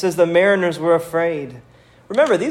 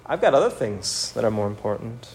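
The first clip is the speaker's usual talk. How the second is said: shrugging excuse; 4 semitones lower, 6 dB quieter than usual. Retold or imitated. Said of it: "we're not allowed to say".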